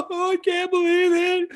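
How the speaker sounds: very high, put-on weepy wail; mock wailing. Host grieving the end of an era, mock wailing. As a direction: overact heartbreak in a squeaky falsetto.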